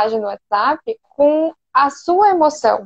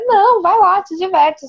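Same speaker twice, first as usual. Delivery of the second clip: eager, upbeat permission; super excited; 6 semitones higher than usual. Shown as a demonstration. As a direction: sound genuinely thrilled to send them off. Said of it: "super animada".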